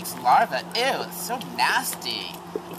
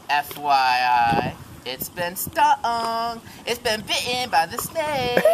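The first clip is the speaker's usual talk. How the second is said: slow dramatic narration; 5 semitones higher, 3 dB louder, much slower than usual. Acted.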